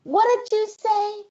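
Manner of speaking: high, startled disbelief; shocked what. Retold or imitated. Mother reacting to her son's remark, high startled disbelief. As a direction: jump up high in alarm. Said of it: "And I said".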